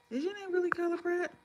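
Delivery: high-pitched incredulous question; astonished. Real, not acted. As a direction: jump right up in disbelief at the name. Astonished at a viewer's name.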